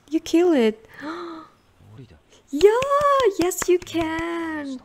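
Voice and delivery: high, loud yell, then a slow high cheer; triumphant cheer. Real, not acted. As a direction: celebrate the win. Cheering Stark's win.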